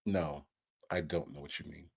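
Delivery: very low, hushed lawyer reply; deadpan lawyer. Acted. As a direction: answer the client very quietly and low. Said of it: "the look on the lawyer's face".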